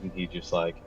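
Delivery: normal talking voice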